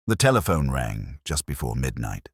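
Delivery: ordinary delivery, nothing marked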